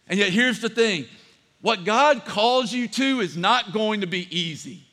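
usual speaking voice

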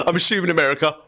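high laughing disbelief. Real, not acted. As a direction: laugh through the words.

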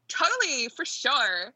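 high, exaggerated Valley Girl; California Valley Girl. Shown as a demonstration. Guest shows the only accent she can do, Valley Girl. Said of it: "California Valley Girl".

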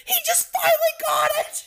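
very high-pitched squealed outburst; held-back shriek of excitement; excited yell. Retold or imitated. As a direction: re-enact a teacher's thrilled yell. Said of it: "and yelled at this one kid".

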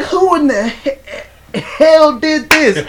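high, loud, slow mock yell; mock yell as Willie. Coworker imitates Willie yelling about the walk-in, slow and high. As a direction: drag it out like furious Willie. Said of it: "he was like".

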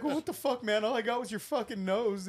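dad mimicking his teenage daughter's whine; high mock teen voice.

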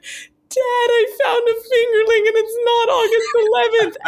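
put-on high-pitched sobbing plea like a little girl; crying child. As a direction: wail it through tears. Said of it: "She's bawling".

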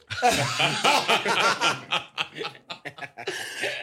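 long high-pitched laugh; laughter. Real, not acted. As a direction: crack up at length. Laughing at his own jab.

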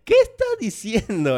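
high, slow, incredulous question; reacting to incomprehensible movie Spanish; baffled disbelief. Real, not acted. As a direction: sound truly lost at the gibberish, high and slow. Reacting to mocked dialogue.

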